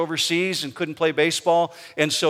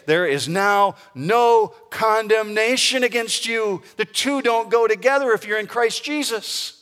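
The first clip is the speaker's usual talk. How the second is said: raised, high-pitched proclamation; emphatic preaching; 7 semitones higher, 3 dB louder than usual. Real, not acted. Pastor quoting Paul to believers, emphatic proclamation. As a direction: declare the verse with rising force.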